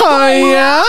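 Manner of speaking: high squealing cry; excited anime fan. Acted. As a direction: squeal the word in mock shock. Shrieked high reply.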